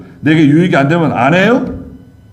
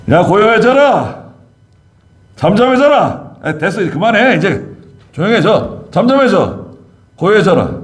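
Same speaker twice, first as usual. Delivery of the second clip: higher, calm but firm hush to the storm; gentle rebuke; 7 semitones higher than usual. Acted. 예수님이 바람을 꾸짖음 재연. (바람을 달래듯 꾸짖는 예수님의 높은 목소리)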